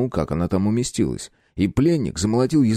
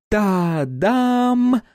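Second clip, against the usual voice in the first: high bright flourish; triumphant ta-da; 12 semitones higher than usual. A narrator's reading. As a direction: show off the win. Klyopka's triumphant reveal.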